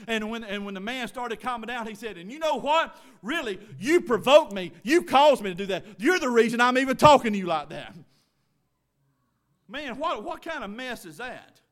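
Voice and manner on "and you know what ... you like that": loud, mock-indignant accusation; heated blame. Man blaming the preacher after screaming at him, heated blame. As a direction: throw the blame back, forcefully.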